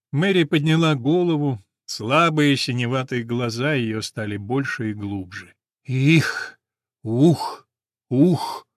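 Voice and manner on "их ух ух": cough. Acted. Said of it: "хотела заржать и закашлялась".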